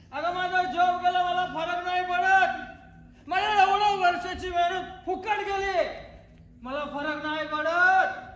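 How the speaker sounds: put-on loud, bitter drunken rant; the drunk husband yells his failures at his wife; drunken angry yell. Drunk man yelling at his wife.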